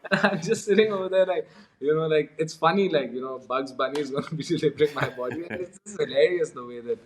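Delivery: high-pitched, giggly telling through laughter; tickled, voice lifted by laughing; amused, laughing. Real, not acted. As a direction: fight back laughter as you tell it. Laughing at own retold absurd story.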